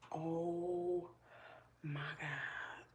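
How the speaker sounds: whispered, weary here-we-go-again; exasperated whisper. Retold or imitated. Owner sees her puppy run off, whispering in exasperation. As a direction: breathe it out, fed up. Dog runs off into woods.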